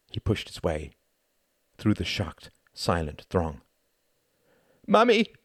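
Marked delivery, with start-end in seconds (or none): 4.9-5.3 put-on loud, high cry of a boy. Tom running to his mother, crying out loud and high. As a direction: call out desperately for Mummy. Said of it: "he cried".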